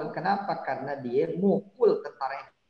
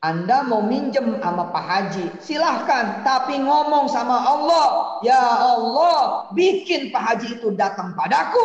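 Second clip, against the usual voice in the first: much louder, higher, preaching at full force; loud exhortation; 9 semitones higher, 10 dB louder than usual. Acted. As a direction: drive the point home to the crowd.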